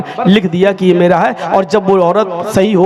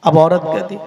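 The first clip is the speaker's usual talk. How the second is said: ordinary talk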